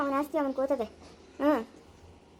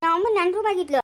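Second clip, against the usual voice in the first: loud defensive shout; angry retort; 6 dB louder than usual. Acted. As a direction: snap it back loudly. Sister shouts in quarrel.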